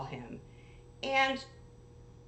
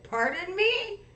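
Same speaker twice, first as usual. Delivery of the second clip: indignant; 10 semitones higher, 6 dB louder than usual. Retold or imitated. Indignant reply to threat.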